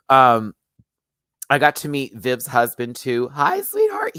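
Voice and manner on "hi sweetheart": high, loud sweet hello; sweet greeting. Retold or imitated. Viv's husband greets her, sweetly.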